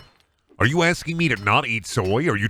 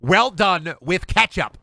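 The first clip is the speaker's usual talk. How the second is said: higher put-on ordering voice; mock customer; 5 semitones higher than usual. Streamer mocking soy haters ordering a steak, higher put-on voice. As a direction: lampoon a diner ordering a steak well done. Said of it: "And then be like".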